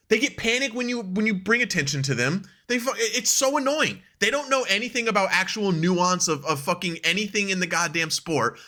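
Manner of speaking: ordinary delivery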